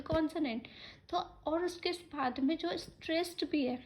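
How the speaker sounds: normal talking voice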